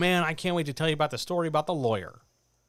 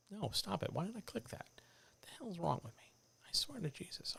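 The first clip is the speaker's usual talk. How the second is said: whispered aside; 4 semitones higher, 13 dB quieter than usual. Real, not acted. Annoyed at a stray click.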